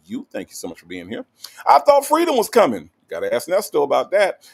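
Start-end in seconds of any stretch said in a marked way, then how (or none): 1.7-2.9 loud, high-pitched exclamation; hammed-up, high cry of surprise; mock outcry. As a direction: call it out as a playful protest. Calls out viewer's name as exclamation.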